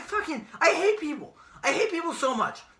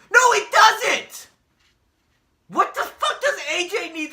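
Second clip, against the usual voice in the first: slow furious shout, much higher and louder; angry shout; 8 semitones higher, 5 dB louder than usual. Real, not acted. Ranting at wrestling fans, furious shout. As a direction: yell it right back at them.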